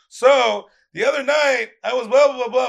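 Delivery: very high, very loud projected voice; shouting over music. Shown as a demonstration. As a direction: shout to someone beside you in a noisy bar. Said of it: "I'm, like, doing that while I'm trying to talk".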